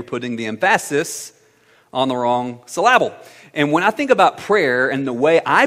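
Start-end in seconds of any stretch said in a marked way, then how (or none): 0.0-3.1 "emphasis" as em-FASS-is, pause, then "syllable" as suh-LAB-ul; deliberate misstressing, "emphasis" em-FASS-is, "syllable" suh-LAB-ul; wrong-syllable stress. Retold or imitated. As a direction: hit the second syllable of "emphasis" and "syllable". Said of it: "she would say".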